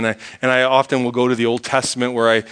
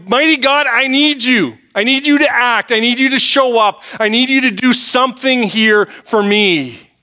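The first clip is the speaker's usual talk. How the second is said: higher and urgent, begging God to act; pleading prayer; 9 semitones higher than usual. Retold or imitated. Preacher acting out a believer's desperate prayer, pleading high. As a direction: cry out to God, pressing each need.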